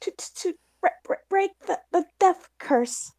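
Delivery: shy high stammer; nervous Aria. Acted. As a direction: blurt it out through nerves. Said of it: "Aria says".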